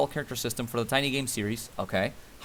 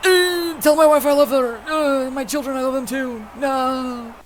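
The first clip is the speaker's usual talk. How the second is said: wounded soldier gasping; 9 semitones higher, 6 dB louder, slower than usual. Pretend. Mock last words of dying knight.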